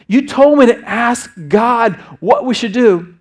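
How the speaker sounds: high, loud, slow and insistent; imitating a son pressing his dad; earnest son insisting. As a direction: drive the point home as the impassioned son. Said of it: "He looks me in the eye and says".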